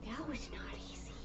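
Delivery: soft whispered aside. Real, not acted. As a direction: breathe it softly. Whisper to dog.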